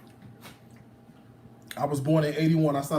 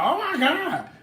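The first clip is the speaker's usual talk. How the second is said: high, gasping mock outrage; mock shocked viewer; 13 semitones higher, 5 dB louder, slower than usual. Cook imitating viewers' comments, high mock gasp. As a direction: squeal it like a scandalized commenter. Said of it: "I know y'all gonna be like".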